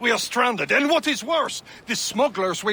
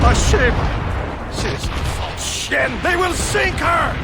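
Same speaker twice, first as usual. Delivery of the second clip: loud, urgent cry of warning; alarmed shout; 5 semitones higher, 4 dB louder, slower than usual. Acted. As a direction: yell it out to raise the alarm. Alarm at an attacking ship.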